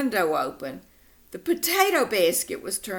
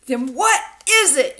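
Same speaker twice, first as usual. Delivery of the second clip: higher, louder demanding shout; yelling; 6 semitones higher, 8 dB louder than usual. Acted. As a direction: demand an answer at a yell. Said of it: "yelled Mom".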